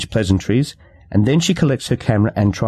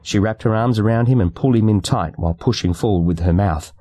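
plain reading voice

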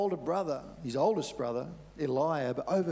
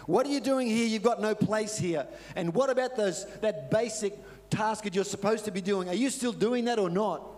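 higher, louder scornful scolding; scornful older brother; 6 semitones higher, 3 dB louder than usual. Retold or imitated. Older brother taunting David at camp, scornful. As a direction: look down on the kid brother. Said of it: "but Eliab was saying".

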